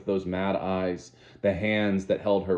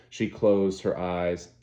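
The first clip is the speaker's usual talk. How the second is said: ordinary delivery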